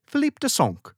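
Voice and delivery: Philippe de Sonck said in an exaggerated French accent, high and nasalized. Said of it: "as he's known to his people".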